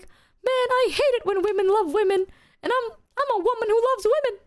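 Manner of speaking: high mock-angry complaint; imitated angry dude. Imagined upset friend ranting, high mock anger. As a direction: play the upset guy, hammed up high. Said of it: "what if he's angry and he's like".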